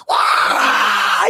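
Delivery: imitated roar of a big crowd; mock arena roar. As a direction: shout it like twenty thousand fans. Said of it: "all you can hear is".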